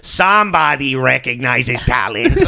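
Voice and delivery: put-on drawn-out, hammy Italian accent; mock Italian accent. Podcaster mocking the photographer's comments, mock Italian accent. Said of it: "and Emery goes".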